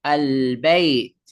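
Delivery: slow "আল বাইত" with a full, clear l; teacher's model "আল বাইত", l held distinctly; lam pronounced. Shown as a demonstration. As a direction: say "আল বাইত" slowly, sounding the l before b. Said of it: "লামটা উচ্চারণ করব".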